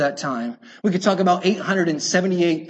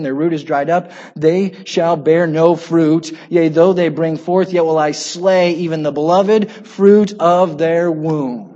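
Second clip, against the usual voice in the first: lower, solemn Bible reading, slowing at the end; scripture reading; 4 semitones lower, 3 dB louder than usual. Acted. Reads scripture aloud.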